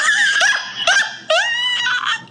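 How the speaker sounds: high shrieking laugh. Real, not acted. Bursts out laughing at joke.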